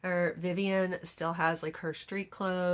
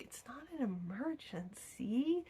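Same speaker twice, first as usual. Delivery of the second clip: whispered to herself; hushed inner voice, unvoiced; 6 semitones higher, 9 dB quieter than usual. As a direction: whisper it like a private thought. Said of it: "I was like".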